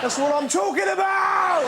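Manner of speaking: high, mock-triumphant yell; triumphant shout. Rude baby getting its way, high triumphant shout. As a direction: crow it in rowdy satisfaction.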